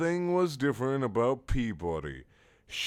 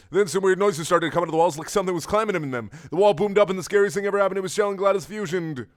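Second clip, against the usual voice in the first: loud, high, very fast suspenseful telling; frantic story narration; 10 semitones higher, 7 dB louder, much faster than usual. A narrator's reading. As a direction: rush breathlessly toward the scary reveal.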